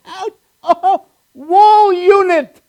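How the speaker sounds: high, slow mock Swedish exclaim; high drawn-out fake Swedish; mock Swedish cry. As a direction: exclaim like a Swede amused. Mock Swedish reacting to names.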